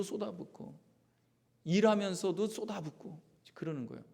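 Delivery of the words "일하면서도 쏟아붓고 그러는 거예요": ordinary talk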